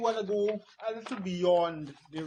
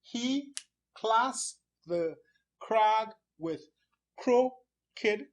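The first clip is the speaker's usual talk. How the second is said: slow, separated syllables with pauses between them; 4 semitones higher, much slower than usual. Shown as a demonstration. Teacher showing syllables in a line, slow and separated. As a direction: count out each syllable distinctly. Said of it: "So let's go through it".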